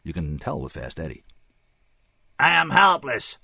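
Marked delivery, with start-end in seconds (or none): 2.4-3.4 high, loud, detached alien voice; dispassionate alien. A narrator's reading. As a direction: state it without emotion, pitched high. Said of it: "the giant alien said dispassionately".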